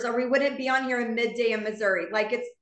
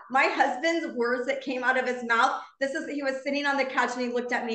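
ordinary delivery

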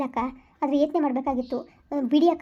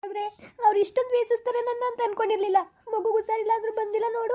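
keen through tears; hurt wife crying that he did not come; 7 semitones higher than usual. Acted. Weeping continues, husband never came.

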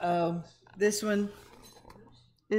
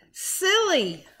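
silly; 8 semitones higher, 4 dB louder than usual. Acted. Reading the silly page.

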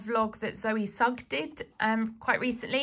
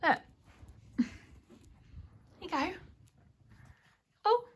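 cat voice; 7 semitones higher, 4 dB quieter than usual. Real, not acted. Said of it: "talks to their cat in a cat voice".

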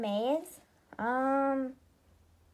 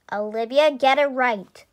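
sassy; 8 dB louder than usual. Acted. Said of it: "you're very sassy".

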